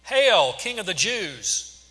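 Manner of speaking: loud, high, drawn-out mock salute; mock him with a slow, swooping proclamation; mocking hail. A narrator's reading. Soldiers hailing Jesus in the purple robe, mocking. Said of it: "they mocked him".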